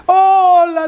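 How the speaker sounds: a bright, booming welcome to a dear friend; joyful greeting. Retold or imitated. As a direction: greet him like a long-lost best friend. Father greets old friend at door.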